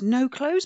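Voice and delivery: "No clothes" rising at the end like a question; questioning tune. Retold or imitated. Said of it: "but with a question mark".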